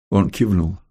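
usual speaking voice

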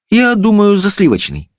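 put-on high girlish answer; decide aloud in a high voice; high-pitched princess reply; princess, considering. A narrator's reading.

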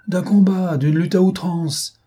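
high, drawn-out dramatic declaration; vehement interjection. A narrator's reading. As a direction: cut in with grand exaggeration.

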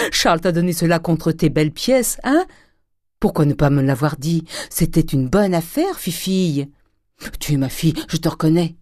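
loud, hearty delight; gleeful approval. A narrator's reading. Grandet praising his daughter's bargain, gleeful approval. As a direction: congratulate her at full voice, elated.

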